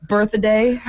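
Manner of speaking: birthday stretched to three syllables, birth-a-day; extra-syllable birthday. Pretend. Answering what to call the birthday sweetheart, birthday as birth-a-day. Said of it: "She just added an extra syllable".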